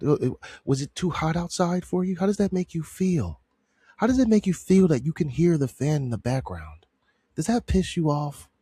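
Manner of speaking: needling imitation of a soothing therapist; mock soft-speak. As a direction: parody a therapist's careful soft questioning. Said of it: "The soft speak pisses me off".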